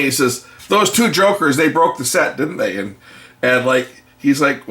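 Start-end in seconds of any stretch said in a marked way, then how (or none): 0.7-2.8 higher-pitched retold accusation; lightly acted knowing challenge; mock accusing. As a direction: press for a confession, as the shop owner. Shop owner grilling the customer.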